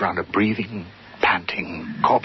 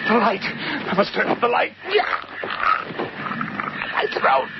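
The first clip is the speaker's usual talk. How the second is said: panicked, strangled cries, much higher; terrified screams. Acted. Harry grappling an invisible thing, screaming in terror. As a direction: fight off an unseen attacker in terror. Attacked in the dark by something.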